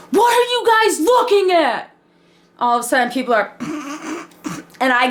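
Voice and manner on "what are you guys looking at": high mock angry shout; angry retort. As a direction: snap at the staring kids. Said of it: "I get really angry".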